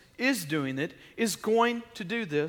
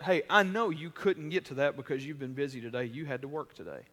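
soft, quieter, gentle praise; encouraging spouse; 4 semitones lower, 6 dB quieter than usual. Pretend. Husband praising his wife for her work, soft and gentle. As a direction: praise your wife tenderly and sincerely. Looking at one another and saying.

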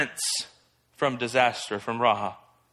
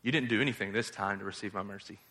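hushed voice of God; 9 semitones lower, 11 dB quieter, faster than usual. Acted. Voicing God to Jonah.

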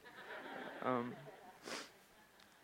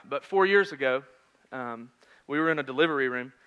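ordinary talk

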